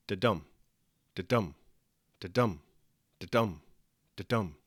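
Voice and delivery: low "Da-dum" beats, each stressed on "dum"; five separate "da-dum" pulses, evenly paused; heartbeat rhythm. Shown as a demonstration. Teacher taps out the line's meter, low "da-dum" stressed on "dum". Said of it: "a rhythm like five heartbeats".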